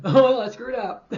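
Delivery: loud, drawn-out comic admission; mock dismay. Predictor whose bold call failed, hammed-up dismay. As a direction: blurt it high, slow and loud, hamming it up. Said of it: "When it doesn't happen".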